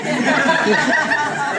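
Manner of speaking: loud burst of laughter; helpless laugh at the memory; big real laugh. Real, not acted. Laughing at his own story.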